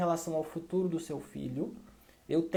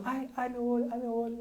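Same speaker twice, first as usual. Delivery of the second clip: put-on child faking pain; 7 semitones higher than usual. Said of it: "botou a mão no olho e começou".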